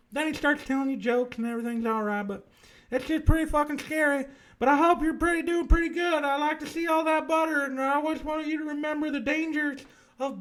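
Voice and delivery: high-pitched camp Southern host with a lisp; effeminate lisping Southern man. Acted. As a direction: play a dead ASMR host, high and lisping. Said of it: "effeminate southern man with a lisp".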